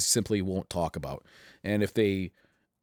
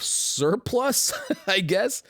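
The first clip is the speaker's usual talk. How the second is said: laughing; 10 semitones higher, much slower than usual. Real, not acted. Laughs at own surplus pile.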